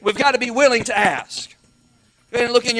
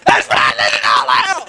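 drawn-out, full-force mock scream; 7 semitones lower, 8 dB louder than usual. Church ladies over a screaming girl at the altar, mock screaming. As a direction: scream it hoarsely, hammed up. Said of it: "she's screaming out".

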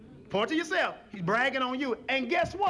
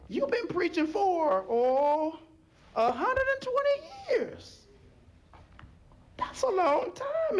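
climbing higher and higher, near tears; pleading rise; 10 semitones higher than usual. Retold or imitated. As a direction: stretch the years out in rising pitch. Preacher pleading as onlooker.

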